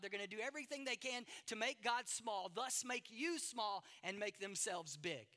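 loud, driving sermon cadence; pounding pulpit delivery; full-force preaching. Acted. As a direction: preach it hard to the congregation.

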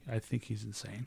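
very soft retold verdict; confiding aside. Coworker confiding to the boss, hushed. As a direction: confide it under your voice.

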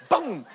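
imitated loud crash of a head on concrete; loud boom. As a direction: shout boom like the impact. Imitating head hitting floor.

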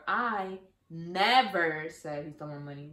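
never leaned on, slow and deliberate; slow read that lands on never; stress on NEVER. Shown as a demonstration. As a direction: push the word never. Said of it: "the words that you stress".

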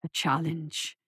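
deep, soft, gurgling monster; low amused beast; bubbling tar voice. A narrator's reading. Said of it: "It sounded like bubbling tar".

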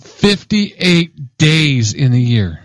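each word spaced out slowly; drawn-out, deliberate "Fifty-eight days"; slow emphatic repeat. Real, not acted. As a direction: drive the count home word by word.

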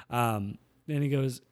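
usual speaking voice

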